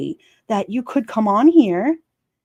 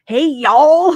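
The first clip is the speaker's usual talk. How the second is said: loud, high drawn-out callout; hollered, exaggerated greeting; mock brawler's holler; 7 semitones higher, 9 dB louder than usual. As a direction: imitate a YouTuber kicking off a fight. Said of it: "they start fighting with each other".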